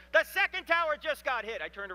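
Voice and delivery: high, loud retold yell down the phone; screaming. Pastor on the phone shouting as the second tower is hit. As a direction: shout the news in sudden shock. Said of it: "suddenly he screams into the phone".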